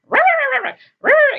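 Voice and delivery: high, loud mock yapping; imitated dog barking. Dogs barking while you're on the phone, mock barking. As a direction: yap like a dog demanding attention. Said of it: "they're just barking".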